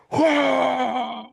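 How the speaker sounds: long, high gushing noise; imitated projectile vomiting. Storyteller showing seconds of vomiting, drawn-out gush. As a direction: hold it out like an endless spray. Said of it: "projectile vomits".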